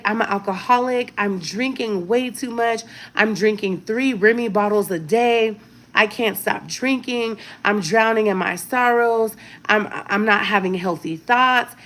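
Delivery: ordinary talk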